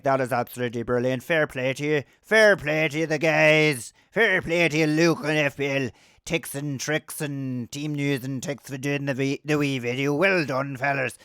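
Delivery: low impression in a put-on voice; FPL General. Fellow pundit praising the team news video, impersonated. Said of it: "that's how he sounds. That is a good impression".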